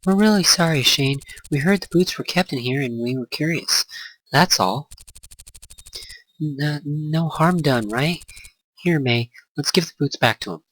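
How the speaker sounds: put-on nervous apologizing girl. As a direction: talk your way out of trouble.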